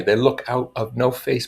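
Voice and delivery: normal talking voice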